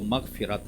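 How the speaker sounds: मग़फ़िरत with "fi" in the middle: magh-fi-rat; correct pronunciation. Shown as a demonstration. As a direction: give मग़फ़िरत its proper "i" after f. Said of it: "लेकिन असल लफ्ज़ जो है". (मग़फ़िरत को सही "मग़-फ़ि-रत" बोलते हुए)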